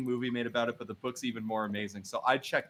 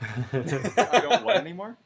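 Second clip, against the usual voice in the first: laughing; 6 semitones higher, 5 dB louder, slower than usual. Real, not acted. Confused by the odd request.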